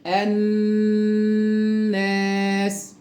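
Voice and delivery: الناس with a very long hummed double n; slow recitation. Shown as a demonstration. Teacher drills the sun lam, very slow recitation. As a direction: hold the ن in الناس, then long aa. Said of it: "يلا نقرأ التدريبات تاني".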